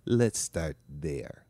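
put-on slow [name]-style accent on "Let's start there"; mock [name] accent. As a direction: draw it out like [name]. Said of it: "as the quote actually says".

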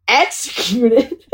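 incredulous question through a laugh; laughing disbelief. Retold or imitated. Laughing at Max's text.

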